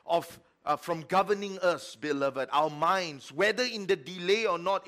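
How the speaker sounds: loud, rising preacher's cadence; full-force preaching. Acted. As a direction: preach it out to the congregation.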